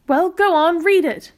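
excited, high and loud; eager urging. Acted. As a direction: urge him on eagerly. Mother urges eagerly.

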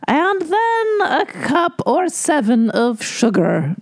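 high, slow, dainty old-lady voice imitating Mary Berry; Mary Berry impression. Imitating Mary Berry's prim recipe voice in a sketch. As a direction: lift it high and linger like a sweet old baker. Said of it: "And she was like".

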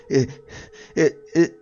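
stammered, high and loud through sobs; terrified husband struggling to speak; sobbing. Acted. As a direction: choke out broken words between sobs. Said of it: "in between sobs".

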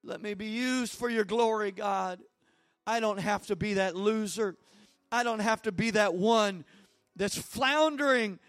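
earnest pleading prayer; fervent prayer. Real, not acted. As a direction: press in with fervor.

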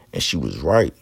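normal talking voice

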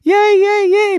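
very high, loud squeals of joy; squealing delighted yay; excited cheer. Acted. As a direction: cheer like a thrilled little toy. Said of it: "All the pins in the box were excited".

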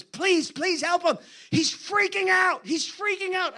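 high, frantic begging; panicked pleading. Retold or imitated. Secretary begging the visiting preacher to help her boss, panicked.

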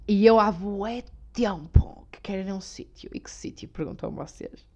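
whispered like ASMR; breathy ASMR whisper, teasing. Acted. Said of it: "É A S M R aqui na zona".

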